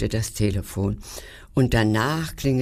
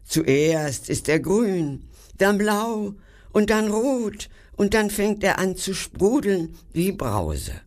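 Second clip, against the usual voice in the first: enthusiastic; 7 semitones higher than usual. A narrator's reading. Inventor describing his gum, enthusiastic. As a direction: savour each colour with delight. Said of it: "rief er begeistert".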